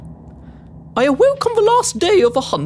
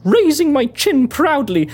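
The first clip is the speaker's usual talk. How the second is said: plain reading voice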